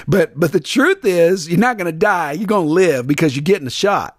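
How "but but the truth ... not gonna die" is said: ordinary talk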